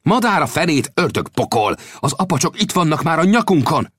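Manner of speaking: high, urgent exclamation; alarmed outburst. Acted. Warning that the Apaches are upon them, alarmed outburst. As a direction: burst out with the warning, pitched high. Alarmed contradiction as enemies attack.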